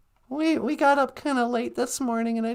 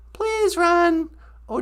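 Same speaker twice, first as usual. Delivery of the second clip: ordinary delivery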